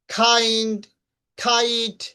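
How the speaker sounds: kind and kite with a puffed "kh"; strong air on the K of kind and kite; aspirated K. Shown as a demonstration. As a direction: say kind, kite as "khaind, khait". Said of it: "এরকম ভাবে উচ্চারণ করব".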